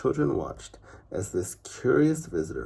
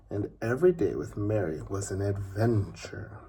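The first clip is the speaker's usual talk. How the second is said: usual speaking voice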